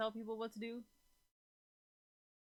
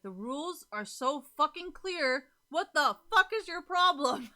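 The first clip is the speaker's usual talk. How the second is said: higher, louder and slow, exasperated rant; frustrated outburst; 7 semitones higher, 12 dB louder, slower than usual. Retold or imitated. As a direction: blow up at someone breaking the rules. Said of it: "I get really frustrated on is I would be like".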